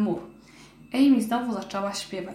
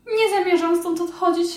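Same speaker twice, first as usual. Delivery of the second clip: high, louder indignant mouse voice; a scared mouse flatly refusing; 8 semitones higher, 5 dB louder than usual. Acted.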